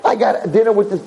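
high squealing mock disbelief; freaking out. Guy invited to dinner by a beautiful model, freaking out. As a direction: squeal it like he can't believe his luck. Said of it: "He's freaking out, he's going crazy".

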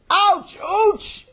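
very high, loud yelps; yelling in pain. Acted. Boy being twisted by his brother, acted pained yelling. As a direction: yelp it sharply, way up high. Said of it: "Sammy started yelling".

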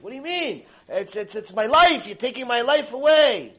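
high, loud stammering protest; desperate yelling. Retold or imitated. Guest answers rabbanit that money is his life, acted desperate yelling. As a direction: cry out as if robbed of everything. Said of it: "why are you yelling so much".

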